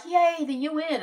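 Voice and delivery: sarcastic high yay for the UN; mock cheer. Mocking her own cheer for the UN, sarcastic and high. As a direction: cheer it up high, tongue in cheek. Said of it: "sometimes I feel like".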